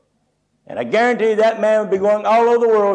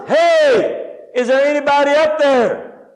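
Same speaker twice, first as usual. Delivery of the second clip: high, drawn-out shout up a cliff; hollering for help; 6 semitones higher, slower than usual. Retold or imitated. Man dangling off a cliff hollers for rescue, hollering. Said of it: "And he hollers".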